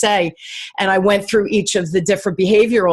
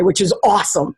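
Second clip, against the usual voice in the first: normal talking voice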